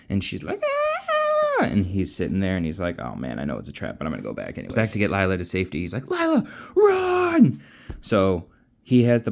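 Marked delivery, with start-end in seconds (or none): 0.6-1.6 a very high mock shriek; mock high scream. Lila screaming on command, high mock shriek. 3.0-4.8 much lower, softer, weary mutter; low resigned hero. Retold or imitated. Kel knowing it's a trap, low and weary. 6.1-7.6 high, slow urgent call; drawn-out warning cry. Retold or imitated. Kel telling Lila to flee, high drawn-out cry.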